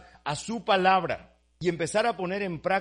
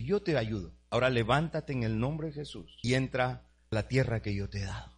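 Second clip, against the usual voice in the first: voice of God; 7 semitones lower, 5 dB quieter than usual. Retold or imitated. The Lord's voice quoted.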